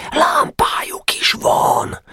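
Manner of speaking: eager whispered exclamation; thrilled but keeping it hushed; slow awed whisper; excited whisper. Acted. Nemecsek excited at the lamp.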